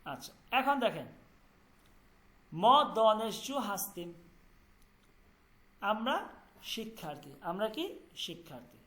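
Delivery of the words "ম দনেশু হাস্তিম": slow, careful Persian model sentence; slow model reading. Shown as a demonstration. Teacher reads a Persian example sentence slowly. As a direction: read it out deliberately for students. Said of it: "Persian example sentence read".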